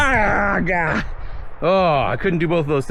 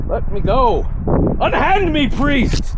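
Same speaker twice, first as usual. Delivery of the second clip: fluttering cries for help; 14 semitones higher, slower than usual. Pretend. Mock struggle with snagging bush.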